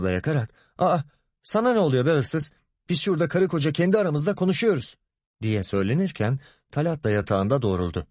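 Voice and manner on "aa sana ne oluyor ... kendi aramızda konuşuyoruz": angry. A narrator's reading. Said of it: "Müfide sinirle".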